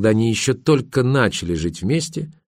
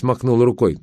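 ordinary delivery, nothing marked

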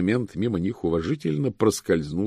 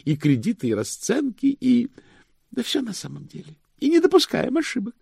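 high, fervent pedant's voice; high-pitched, proud clerk; zealous chief cashier. Acted. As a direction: extol human arithmetic in a raised voice.

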